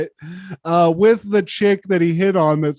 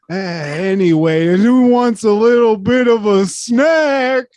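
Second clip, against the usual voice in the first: mock wiseguy; 3 semitones higher, 5 dB louder than usual. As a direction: call out casually to the gang, stretched. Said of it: "he's like".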